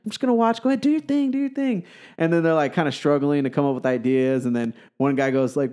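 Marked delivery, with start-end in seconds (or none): 0.1-1.8 high-pitched, flamboyant imitation; airy show of standing back, in a high camp voice; flamboyant script doctor. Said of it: "he's all super flamboyant and everything".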